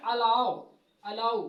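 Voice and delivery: अलाउ drawn out slowly, said like a-LAU; teacher sounds out अलाउ slowly and clearly; correct pronunciation. Shown as a demonstration. Teacher models अलाउ for students, slow and clear. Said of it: "इसे शुद्ध में पढ़ा जाएगा".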